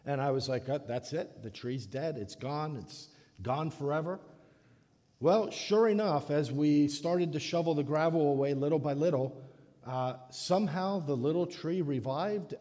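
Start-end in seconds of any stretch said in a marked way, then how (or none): none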